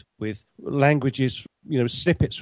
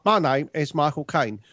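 younger Cockney actor impression; Michael Caine; 2 semitones higher than usual. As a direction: imitate the star's early Cockney voice. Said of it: "Michael Caine used to be that".

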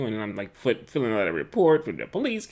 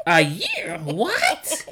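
grandmother's What, much higher; mock shock; 8 semitones higher, 3 dB louder, much slower than usual. Husband imitates grandmother's shocked question, much higher. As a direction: shoot way up in disbelief. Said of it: "abuela goes".

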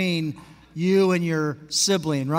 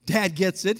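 ordinary talk, nothing marked

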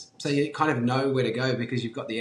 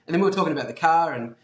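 normal talking voice

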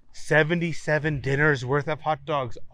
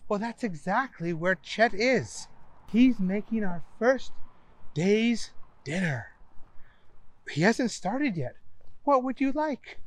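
high, polite hostess voice; sweet and helpful, pitched up; Donna's voice; 7 semitones higher than usual. Acted. Donna, polite stewardess figure.

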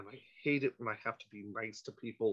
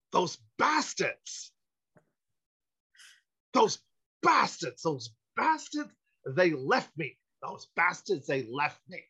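slow, drawn-out yell of "bastards", higher and louder; Christopher Walken; 4 semitones higher, 4 dB louder, slower than usual. Retold or imitated. Stranded man yelling across the parking lot, Walken-style drawl. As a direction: bellow like a man his friends abandoned. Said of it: "Holy shit, that's Christopher Walken".

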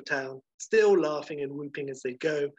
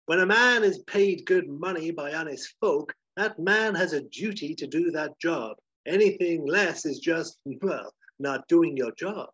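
raised, declaiming hero voice; proudly earnest; 4 semitones higher, 5 dB louder than usual. Acted. Ned steps forward to lecture on honest work, proudly earnest. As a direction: proclaim it proudly, slowing at the end. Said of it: "like, really proud of himself".

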